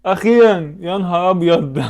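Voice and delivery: high, loud cry of relief; finally got it, let it burst out; real joy at guessing close; excited relief. Real, not acted. Finally close to right price.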